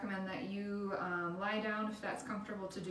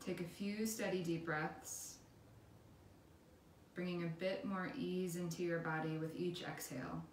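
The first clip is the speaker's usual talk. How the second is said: guided meditation; 5 dB quieter than usual. Acted. Instructor leading listeners through a body scan, calm guided meditation.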